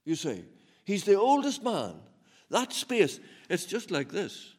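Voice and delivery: loud, high, ringing preacher's climax; full-force preaching. Acted. Preacher thundering to the congregation about God's love. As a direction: preach it out to the whole hall, building loud.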